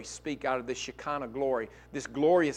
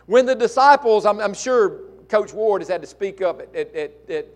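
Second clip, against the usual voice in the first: loud preaching; 8 semitones higher, 13 dB louder than usual. Acted.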